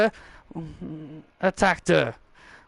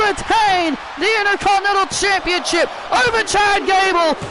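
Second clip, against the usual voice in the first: live play-by-play; 10 semitones higher than usual. Acted. Commentator calling the winning pin, excited shout. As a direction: call the finish at full throat.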